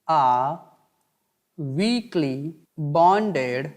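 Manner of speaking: very slow, word by word, with long pauses; slow dictation. Shown as a demonstration. Teacher dictating a note to the class, very slowly. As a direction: dictate each word slowly so students can write it down. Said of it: "मैं नीचे यहाँ पे लिख दूँ".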